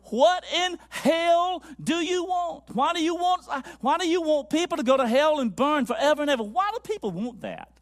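retold preacher's thundering questions; imitating a fervent preacher. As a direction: preach it out as an impassioned challenge.

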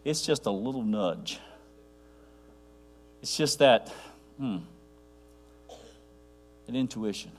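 soft, trailing off into pauses; low and understated, with long pauses; gentle nudge. Shown as a demonstration. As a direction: let it shrink to a quiet, hesitant hint. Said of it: "how God speaks to you".

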